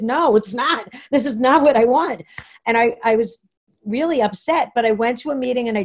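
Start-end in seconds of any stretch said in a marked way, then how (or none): 0.0-2.2 firm refusal. Retold or imitated. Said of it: "I said".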